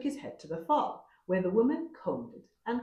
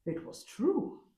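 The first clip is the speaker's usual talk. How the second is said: gasped; 3 semitones higher, slower than usual. A narrator's reading. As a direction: gasp it out in awe. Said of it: "he gasped".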